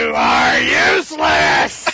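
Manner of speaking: slow, deep, contemptuous shout; Green Goblin voice. Acted. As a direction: drag out a low villain roar. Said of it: "your Norman Osborn Green Goblin".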